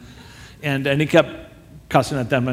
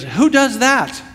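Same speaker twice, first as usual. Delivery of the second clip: tag incredulous; 7 semitones higher, slower than usual. Retold or imitated. Acted rock star's reaction to verse.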